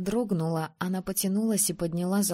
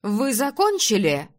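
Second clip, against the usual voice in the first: раздражённый громкий окрик; рявкнуть вопрос, громче обычного; рявканье; 3 semitones higher, 5 dB louder than usual. A narrator's reading. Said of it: "рявкнула она". (рявкнуть сердито)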